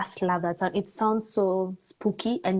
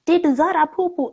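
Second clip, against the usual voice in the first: higher, louder mimicked mom; sing-song quote of mom; mom's cooing question; 8 semitones higher, 5 dB louder than usual. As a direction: imitate a mother asking the puppy. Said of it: "So mom always says".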